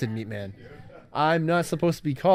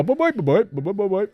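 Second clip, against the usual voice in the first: higher chanted creature babble; a hint of creature gibberish; Bleep language; 7 semitones higher than usual. Shown as a demonstration. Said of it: "they go".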